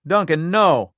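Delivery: cracked desperate cry. A narrator's reading. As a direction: stop him with your voice.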